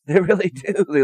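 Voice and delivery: laughing while speaking. Real, not acted. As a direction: laugh through the words. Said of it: "I'm laughing".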